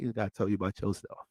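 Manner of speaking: usual speaking voice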